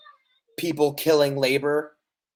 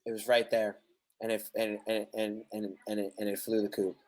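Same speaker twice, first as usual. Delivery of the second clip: dropped much softer and lower, trailing off; soft defeated murmur; quiet deflated mumble; 8 semitones lower, 9 dB quieter, faster than usual. Real, not acted. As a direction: let it sink away as the thought escapes. Lost his example, deflated.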